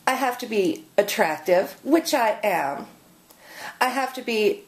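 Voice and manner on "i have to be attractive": normal talking voice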